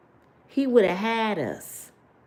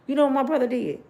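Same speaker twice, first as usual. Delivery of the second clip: normal talking voice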